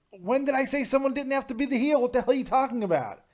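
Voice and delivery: high-pitched, incredulous comeback; indignant retort. Real, not acted. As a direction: fire back indignantly at a silly accusation. Indignant retort to a chat comment.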